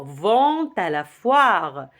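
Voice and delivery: "Vont à" linked with a clear t sound; slow model of the t liaison in "Vont à". Shown as a demonstration. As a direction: stretch "Vont à la foire" slowly, sounding the linking t. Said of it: "On n'oublie pas la liaison".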